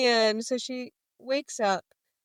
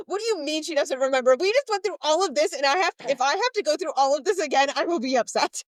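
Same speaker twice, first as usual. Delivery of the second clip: higher and louder, playfully indignant rant; mock outrage; 6 semitones higher, 4 dB louder than usual. Fan retelling her reaction to a plot twist, mock outraged. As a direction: replay your mad reaction to the book, higher and louder. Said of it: "I was so mad about it".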